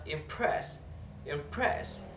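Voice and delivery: "impress" with the stress on PRESS; teacher's model of "impress", leaning on PRESS; stress on second syllable. Shown as a demonstration. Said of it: "In the seconds".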